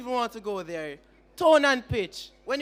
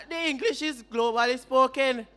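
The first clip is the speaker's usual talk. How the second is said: usual speaking voice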